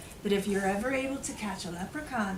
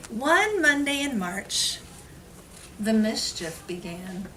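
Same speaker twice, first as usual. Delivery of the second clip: lively story reading; 2 semitones higher, 5 dB louder than usual. A narrator's reading. Teacher reading the rhyming book aloud to children. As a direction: read it with storybook sparkle.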